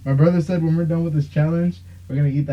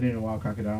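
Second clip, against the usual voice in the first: noticeably softer, muttered under the breath; said low; 4 semitones lower, 10 dB quieter, faster than usual. Retold or imitated. Brother mutters it walking off from the bartender. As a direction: say it low so she barely hears. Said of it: "And he said".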